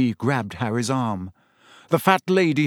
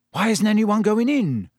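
higher-pitched, curious question; 8 semitones higher than usual. A narrator's reading. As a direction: ask it with open curiosity, pitch raised. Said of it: "said Ron curiously".

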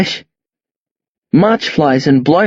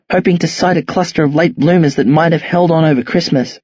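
plain reading voice

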